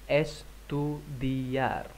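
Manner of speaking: Estudiar said very slowly and softly; very slow model word. Shown as a demonstration. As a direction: crawl through Estudiar for learners. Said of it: "Vamos, estudiar".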